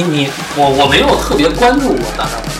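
ordinary talk

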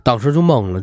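ordinary delivery

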